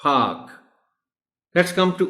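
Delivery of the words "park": park as a long "paak"; correct, silent r. Shown as a demonstration. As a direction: model park without r. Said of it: "So it's park". (park کو "پاک"، r خاموش)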